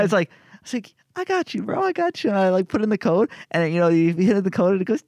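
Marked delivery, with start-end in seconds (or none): 1.2-2.3 much higher, breezy bro voice; cocky self-quote. Retold or imitated. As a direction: toss it off like a cool helper. Narrator quoting himself reassuring tech.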